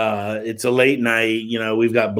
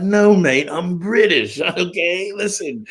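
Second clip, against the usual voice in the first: higher-pitched imitated British accent, protesting; 8 semitones higher, slower than usual. British friend insisting to the police he's British, British accent. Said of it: "And he's got this British accent".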